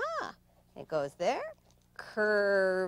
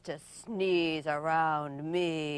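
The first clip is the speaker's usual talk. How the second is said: low slow predator voice; menacing leopard; 5 semitones lower, slower than usual. Acted. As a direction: loom over them, deliberately. Leopard threatens prey.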